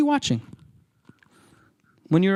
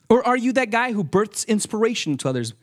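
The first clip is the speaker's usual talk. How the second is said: ordinary talk, nothing marked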